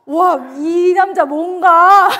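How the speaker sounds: loud, drawn-out bewilderment; reenacted indignant amazement; indignant disbelief. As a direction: react as if taken aback, slowly and loudly. Taken aback by boyfriend's words.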